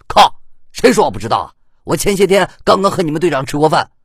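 high, heated protest; indignant retort. A narrator's reading. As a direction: bluster back at being called out. 魏琛被揭穿后急着争辩. (急着高声争辩)

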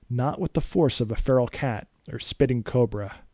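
usual speaking voice

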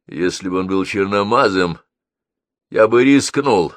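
plain reading voice

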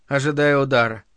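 usual speaking voice

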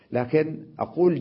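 ordinary talk

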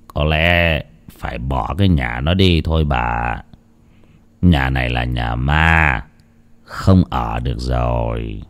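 low, soft, slow and drawn-out; a defeated old husband giving up, voice sunk low; dazed, shaken husband. Acted. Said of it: "thất thần quay sang bảo vợ". (giọng ông chồng thất thần, trầm, chậm và nhỏ)

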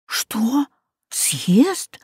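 breathless, frightened whisper; boy whispering in shock; horrified whisper. Acted. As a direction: gasp it out in terror. Boy horrified at being eaten.

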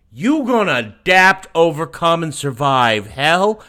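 loud mocking disbelief; incredulous shout. Real, not acted. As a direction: yell it back in disbelief.

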